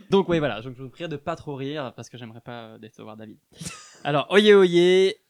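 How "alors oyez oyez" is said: town crier. Pretend. Opens chronicle with crier call.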